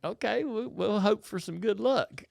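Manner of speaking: a hint of hopeful little girls; daughters' voice. Daughters quoted hoping for luck.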